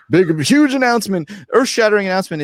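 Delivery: hyped-up sales pitch voice; marketer mode. Pretend. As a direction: sell it like an infomercial. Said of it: "now I'm gonna go in like, in like, uh, the mode of the, uh, marketer".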